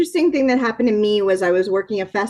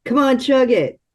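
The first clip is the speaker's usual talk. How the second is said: lightly voiced comic impression; David Spade; 2 semitones lower than usual. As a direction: do a light impression of a comedian on a first date. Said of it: "David Spade's impression of".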